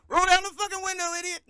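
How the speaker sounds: high, very fast shouted punchline; mock yelling. Friend yelling the cop's line back as the punchline, high and fast. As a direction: scream it back in the cop's face. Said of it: "I was like".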